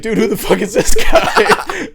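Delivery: loud, high, cracking-up retelling; laughing quote. Retold or imitated. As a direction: quote a baffled friend through laughter, slowly. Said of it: "He was like".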